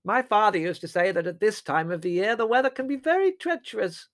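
very high, loud, rambling aunt voice; dotty aunt. A narrator's reading. Aunt Fan reminiscing about the weather, dotty aunt. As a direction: ramble on brightly, oblivious to the upset.

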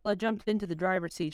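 usual speaking voice

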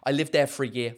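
ordinary talk

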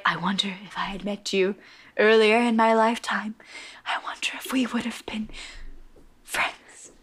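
whispered, faltering last words; dying words. Retold or imitated. Dying fighter confides a last wish, in an acted fading whisper. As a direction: breathe it out softly, with long pauses.

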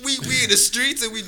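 loud, high-pitched objection; mock indignant protest. Young man objects to pastor's assumption, loud mock indignation. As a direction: argue back loudly, affronted.

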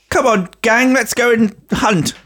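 put-on very high-pitched children's adventure voice; rally the gang, hammed-up; mock jolly gang leader. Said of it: "She looks like she says".

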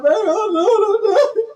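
hammed-up tearful wail; mock crying. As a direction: imitate your own whiny sobbing call. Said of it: "I called my husband crying".

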